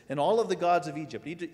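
ordinary talk

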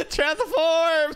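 put-on high, laughing magical girl call; bright magical girl. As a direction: call it like an anime heroine.

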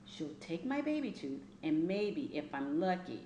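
usual speaking voice

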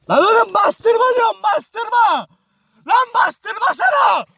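furious, panicked shouting; angry shouting. Real, not acted. Trapped friend yells at pranksters.

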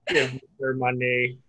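stiff robot demand. Pretend. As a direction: command it like a machine. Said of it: "Now you sound like a robot".

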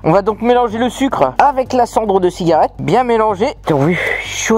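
muffled behind respirator; speaking inside gas mask; through a face mask. Real, not acted. Seen 0: Half-face respirator worn over mouth and nose throughout; off before and after.

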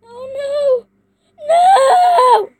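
rising panic into a laughing shout; panicked shout. Real, not acted. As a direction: cry out as the game goes wrong. Character dying in game.

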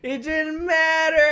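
high indignant shout; full shout. Real, not acted. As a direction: scream it at the screen. Yelling at game twist.

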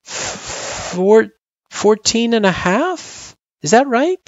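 put-on high, hesitant child voice, questioning; unsure kid. Frisk checking an odd answer, unsure. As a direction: feel your way slowly to the answer.

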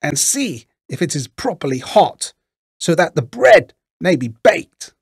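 high-pitched witch giving instructions. A narrator's reading. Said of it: "said the witch".

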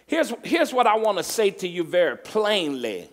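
normal talking voice

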